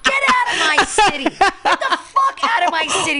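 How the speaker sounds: high, loud mock screaming, laughing through it; retold yell. Retelling yelling at a stranger, loud and high. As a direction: yell it at the tourist, half laughing. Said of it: "And I just yelled".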